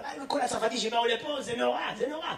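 confiding mock French gossip. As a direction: drop it to a near-whisper like overheard café talk. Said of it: "הוא אומר".

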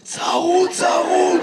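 high, strained hoarse shout. Acted. Man explaining why he sounds odd, hoarse and strained. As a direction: push it out through a worn-out voice. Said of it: "למה אתה נשמע ככה".

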